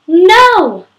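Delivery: a sudden, very high, very loud cry of denial; a sharp, piercing NO; anguished shout. Acted. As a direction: scream the word out in despair. Dramatic read of a tragic scene.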